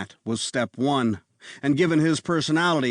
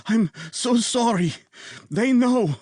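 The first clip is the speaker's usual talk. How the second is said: gasping apology; 11 semitones higher than usual. A narrator's reading. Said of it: "the man gasped".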